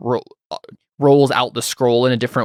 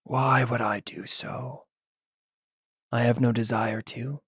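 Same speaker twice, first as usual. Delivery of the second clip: raspy voice; 7 dB quieter than usual. Acted. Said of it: "a raspy voice say".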